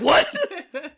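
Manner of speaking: high, loud, laughing disbelief; shocked what. Pretend. Imagined player reacts to the ending.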